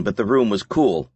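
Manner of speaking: usual speaking voice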